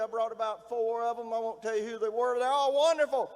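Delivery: normal talking voice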